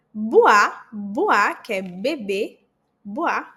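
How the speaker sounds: boire said as bwar, first take loud; oi sound demo. Shown as a demonstration. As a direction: stress the wa in boire. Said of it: "O I tem som de U A".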